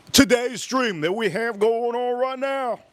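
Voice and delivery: excited announcement. Real, not acted. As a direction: hype the stream, stretching the words high. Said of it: "I'm excited for today's stream".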